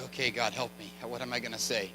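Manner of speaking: low, soft plea under the breath; hushed plea. Retold or imitated. Visitor silently asking God what to say, hushed plea. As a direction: pray quietly to yourself, stuck for words. Retold helpless private prayer for words.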